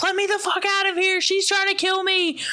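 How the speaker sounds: very high, fast imitation scream; mock screaming. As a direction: shriek it in falsetto at contained volume. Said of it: "this lady is, like, screaming".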